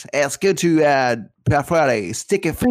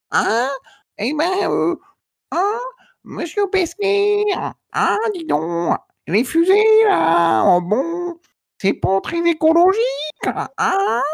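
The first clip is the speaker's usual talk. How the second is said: [name] impression; 12 semitones higher than usual. Rock star needling the astronaut about rockets, in a high Jagger voice. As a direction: tease like a swaggering rock legend. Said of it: "il fait [name]".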